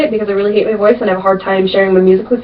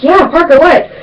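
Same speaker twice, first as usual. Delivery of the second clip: higher, louder, urgent; eager reply; 8 semitones higher, 4 dB louder than usual. Retold or imitated. Big sister at the hospital bed answering her waking sister, urgent and high. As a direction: jump up and answer at once. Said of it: "I, like, jumped up and I was like".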